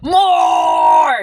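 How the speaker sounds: long, high, loud bellow; drawn-out greedy holler; greedy shout. Acted. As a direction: stretch it into one big hammed-up yell. Channeling someone's greed for more.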